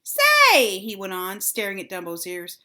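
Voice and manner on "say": sudden high exclamation; excited. A narrator's reading. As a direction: blurt with an idea. Sudden idea.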